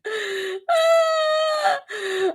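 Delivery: high shrieking laugh fit; squealing laugh. Real, not acted. Cracking up at her own joke threat, high shriek.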